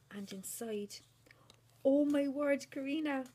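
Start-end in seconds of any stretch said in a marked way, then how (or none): 1.8-3.2 high, loud delighted cry; excited squeal. Real, not acted. Opening Karina's box, squealing with delight. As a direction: burst out in real delight at the gift.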